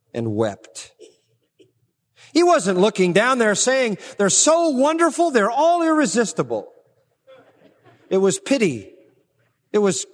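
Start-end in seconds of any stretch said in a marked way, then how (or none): 4.2-6.7 mock-adoring, pitched much higher; mock gushing. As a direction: gush sarcastically like a doting fan. Mocking a gushing admirer.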